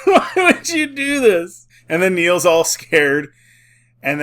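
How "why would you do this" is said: laughing disbelief. Real, not acted. Laughing at dumb raid strategy.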